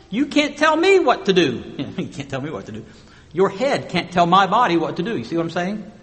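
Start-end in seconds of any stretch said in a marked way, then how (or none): 0.1-1.6 playful mock rebellion; mock defiance. Body defying head, mock voice. 1.8-2.9 soft, quick, laughing at the joke; amused laughing repeat. Real, not acted. Repeats line through laughter.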